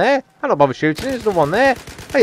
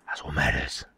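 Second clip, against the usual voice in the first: breathy whispered repeat, much lower and softer; 11 dB quieter than usual. Pretend. Gamer whispering to himself on a recording. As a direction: drop to a secret whisper. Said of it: "Why am I saying it like that?".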